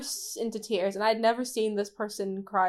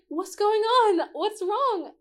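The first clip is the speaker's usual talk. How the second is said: high, slow, startled asking; alarmed concern; 9 semitones higher, 3 dB louder, slower than usual. Retold or imitated. Narrator finding the teammate sobbing, high and alarmed. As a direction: sound caught off guard and worried.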